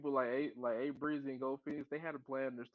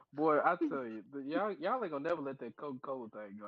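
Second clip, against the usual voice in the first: usual speaking voice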